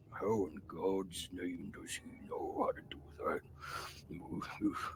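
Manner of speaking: slow, winded whisper between puffs; out of breath. Acted. Slow big orc trying to follow these people, breathlessly whispered. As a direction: act exhausted, gasping the words almost voicelessly. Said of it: "I'm out of breath".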